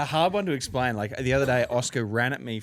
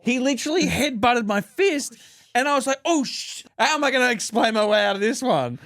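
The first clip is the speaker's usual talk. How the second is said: mock panic; 9 semitones higher, 3 dB louder than usual. Dad panicking after kid headbutts fist.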